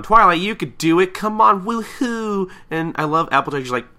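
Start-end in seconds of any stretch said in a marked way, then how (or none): 0.0-2.5 high, loud crowd cheer; cheering her on. Acted. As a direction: cheer like excited friends on the sidelines. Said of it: "everybody's cheering her on".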